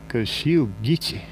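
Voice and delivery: ordinary delivery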